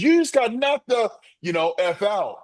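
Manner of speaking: high-pitched Chris Tucker bit. Retold or imitated. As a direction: squeal it like the comedian in the movie. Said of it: "gets over top and goes".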